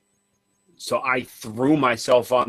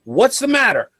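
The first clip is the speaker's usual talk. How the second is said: grandmother asking; 11 semitones higher, 5 dB louder than usual. Retold or imitated. Grandmother voiced in retold story.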